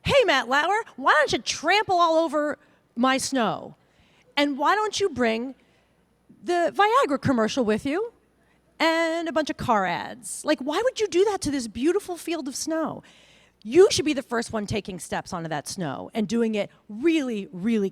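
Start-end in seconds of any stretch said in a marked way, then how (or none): 0.0-11.7 mock indignant complaint. Speaker mocking her own morning self at the TV, hammed-up indignant. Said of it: "You're like".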